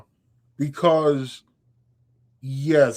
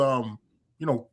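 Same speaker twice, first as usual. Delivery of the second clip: ordinary talk, nothing marked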